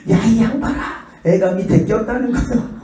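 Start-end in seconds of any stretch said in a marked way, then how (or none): 0.1-1.0 higher, hollering at the driver; mock angry yell. Other driver yelling a warning at the preacher, high and urgent. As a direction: call him out sharply. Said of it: "그러니까".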